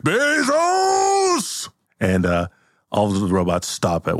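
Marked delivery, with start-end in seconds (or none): 0.0-1.7 a huge high cry of the name; shouted name. Acted. Santa roaring his enemy's name. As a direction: bellow it up at the ceiling.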